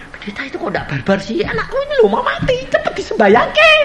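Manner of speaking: mother's high, fast, pleading urge; anxious mother. Retold or imitated. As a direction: rush the preacher, voice climbing high. Anxious mother urging the preacher. (ibu yang panik, suara melengking tinggi dan cepat)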